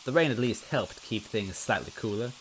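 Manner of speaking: ordinary delivery, nothing marked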